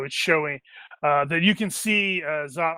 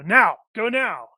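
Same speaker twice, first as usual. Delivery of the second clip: raised, urgent order; urgent command; 6 semitones higher, 5 dB louder than usual. Acted. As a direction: push them through, now. NPC urges party through portal.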